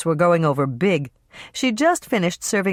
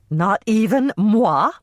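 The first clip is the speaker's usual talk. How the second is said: a hint of a coy diva, slow and higher on "moi"; Miss Piggy moi; 5 semitones higher, 4 dB louder, slower than usual. Mother fishing for the price, lightly mock Miss Piggy. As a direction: draw out "Not even moi?" a little higher, lightly piggy-grand. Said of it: "sounded just like Miss Piggy when she referred to herself as moi".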